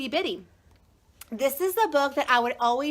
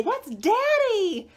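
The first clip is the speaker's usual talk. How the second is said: daughter's line; 7 semitones higher, slower than usual. Retold or imitated. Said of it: "She would say".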